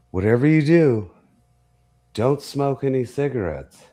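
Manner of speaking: a lightly voiced nurse; imitating a nurse. Staff warning quoted in story.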